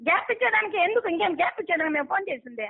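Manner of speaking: ordinary talk, nothing marked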